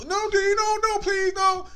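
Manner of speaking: high, screamed mock begging; imitating pleading son. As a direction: shriek it like a panicking kid. Acts the son begging not to go.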